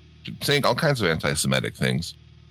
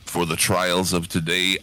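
ordinary talk, nothing marked

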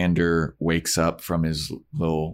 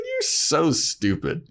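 mocking sneer; 9 semitones higher, slower than usual. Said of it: "He's like".

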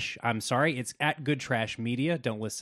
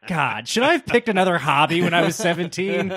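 exasperated; 6 semitones higher, 10 dB louder than usual. Retold or imitated. Exasperated self-quote.

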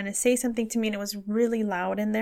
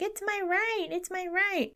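high-pitched mock tantrum; mocking whine; 10 semitones higher than usual. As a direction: mimic an entitled complainer, pitched way up. Mocking people refusing masks.

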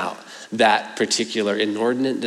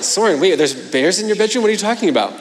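surprised; 7 semitones higher, faster than usual. Retold or imitated. Dad startled by bears claim.

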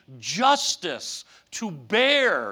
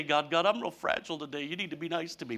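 fragile pleading; 5 semitones lower, 9 dB quieter, faster than usual. Retold or imitated. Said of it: "'cause I usually say".

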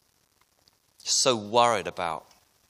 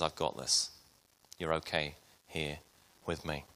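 softer, low, comforting promise; tender reassurance; 6 semitones lower, 10 dB quieter than usual. Acted. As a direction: speak it like holding someone's hand. Jesus holding our hand.

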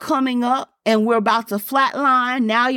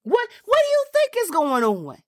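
much higher, fervent preaching cry; preaching at full force; 9 semitones higher than usual. Acted. Preacher crying God's words to the congregation, at full force. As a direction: lift the voice and cry it out to the church.

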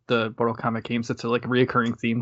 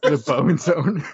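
higher, louder mock-title echo; long, playfully stretched vowels; playful drawn-out echo; 5 semitones higher, 5 dB louder, much slower than usual. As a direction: echo the silly name very slowly. Echoing the silly name back.

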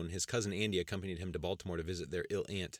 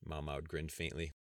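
ordinary delivery, nothing marked

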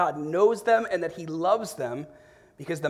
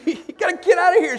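much higher and louder mock yell; 13 semitones higher, 9 dB louder than usual. Dad replaying his order to his wife, hammed-up high yell. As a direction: throw it out like a playful shout.

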